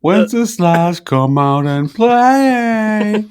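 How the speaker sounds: high, very slow sing-song taunt; taunting call. Pretend. Host taunts Wenceslas to come out, high and drawn out. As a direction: draw it out like calling a kid outside.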